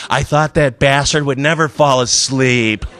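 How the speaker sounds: a lightly voiced exhausted wife, slow and drained; weary wife. Former wife coming down to breakfast, slow tired relief. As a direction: drag it out like she finally got free of him.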